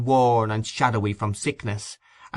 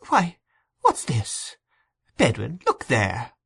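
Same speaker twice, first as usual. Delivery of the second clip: sudden exclamation; 3 semitones higher, slower than usual. A narrator's reading. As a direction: exclaim as if suddenly struck by what is seen. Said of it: "this sudden exclamation".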